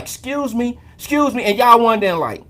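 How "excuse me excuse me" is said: mock polite excuse me. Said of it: "walk with that finger up like".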